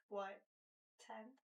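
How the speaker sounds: ordinary talk, nothing marked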